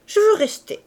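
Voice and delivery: put-on high, loud childlike demand; insistent. A narrator's reading. Cosette demanding to stay with the men, insistent.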